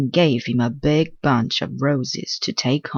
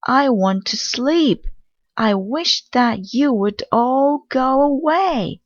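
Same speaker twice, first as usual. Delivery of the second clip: high, louder shout of complaint; 10 semitones higher, 3 dB louder than usual. A narrator's reading. Sleepy mouse shouting for the crickets to leave, high and louder. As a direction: keep shouting, worn out and fed up. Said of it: "shouted the mouse".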